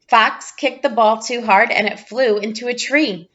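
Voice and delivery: plain reading voice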